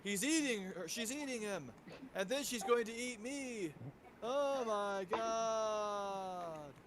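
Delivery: slow-building, badly overacted meme quote; fake-sounding bad actor. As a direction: imitate a terrible actor, stretching into a long final wail. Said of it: "in the most fake sounding way".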